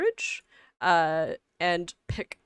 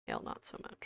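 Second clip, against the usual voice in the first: whispered, barely audible repeat; muttered echo; 18 dB quieter, faster than usual. Real, not acted. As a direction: say it again under your breath. Repeats own remark under breath.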